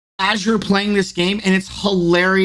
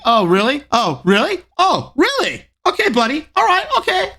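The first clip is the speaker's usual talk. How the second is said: Buffalo-free teasing streamer voice climbing much higher with each line; mock streamer taunting; 7 semitones higher than usual. Mocking a streamer taunting an enemy mid-fight, rising ever higher. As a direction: tease the enemy, pitch building from plain to much higher. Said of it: "watching your streams and you're like".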